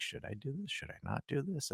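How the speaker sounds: murmured, hesitant self-questioning; hushed self-doubt. Retold or imitated. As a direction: ask yourself under your breath. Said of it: "I'm like".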